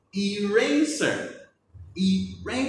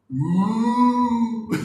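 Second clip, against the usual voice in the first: spooky ghost oo; 4 semitones higher, 7 dB louder than usual. Shown as a demonstration. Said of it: "like a spooky ghost".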